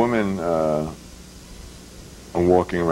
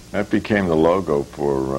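usual speaking voice

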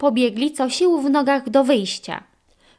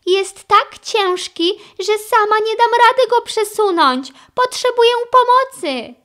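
high, loud plea for help; urgent little-character voice, pitched very high; Sky reporting; 12 semitones higher, 5 dB louder than usual. A narrator's reading. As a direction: report the trouble and beg for backup. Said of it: "zaraportowała Sky Ryderowi".

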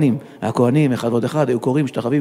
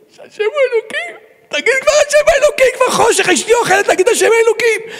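very high, hammed-up wailing shout; mock-crying desperate yell to hurry things along; tearful shouting; 4 dB louder than usual. Starving worshipper joins the cantor to speed things up, wailing shout. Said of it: "ואני צועק".

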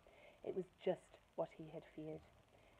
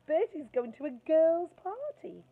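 much higher, louder, slow sing-song taunt; mocking tease; 10 semitones higher, 12 dB louder than usual. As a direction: tease a mate in front of everyone. Darren teasing.

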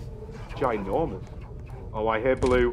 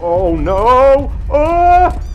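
panicked; 2 semitones higher, 8 dB louder, much slower than usual. Real, not acted. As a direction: yell in a real panic. Chased by monsters.

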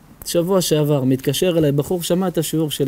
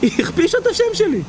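wailing and crying; 14 semitones higher than usual. Acted. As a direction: cry out each grievance in a high wail. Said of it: "בוכה, מייללת".

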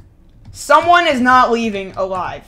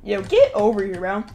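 ordinary talk

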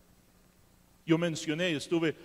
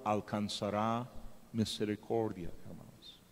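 whispered appeal; 9 semitones lower, 9 dB quieter than usual. Real, not acted. Hushed appeal to congregation.

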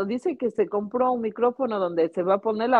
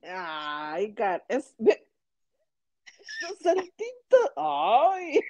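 laughing; 3 semitones higher, much slower than usual. Real, not acted. Laughing at the dance moves.

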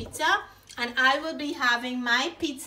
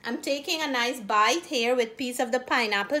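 ordinary delivery

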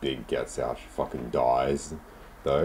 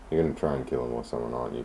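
ordinary delivery

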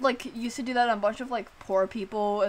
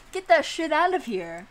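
pushed up, louder and higher, brash; hammed-up surgeon tossing out brain; mock surgeon cheer; 5 semitones higher, 5 dB louder than usual. Mock doctor removing brain.

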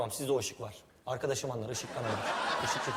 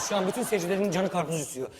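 usual speaking voice

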